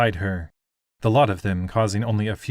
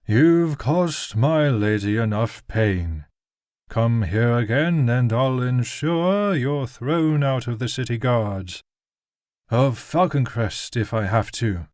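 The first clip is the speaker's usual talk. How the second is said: sneering nobleman; 3 semitones higher, slower than usual. A narrator's reading. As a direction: threaten him slowly, with contempt. Nobleman keeps threatening.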